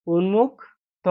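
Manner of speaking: drawn-out teacher model; 1 slow spelled-out word. Shown as a demonstration. Teacher to pupils, উন্মুক্ত said very slowly. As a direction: stretch each sound of উন্মুক্ত slowly. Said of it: "teacher repeats model".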